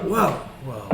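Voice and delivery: mildly alarmed, voice jumps up; freaking out. Acted. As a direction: back off in a touch of panic. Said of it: "really start to freak out".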